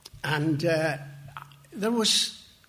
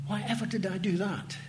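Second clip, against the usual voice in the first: softer, musing to himself; a private regretful murmur; quiet inner thought; 5 dB quieter than usual. Retold or imitated. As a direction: wonder it quietly under the breath. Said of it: "I thought to myself".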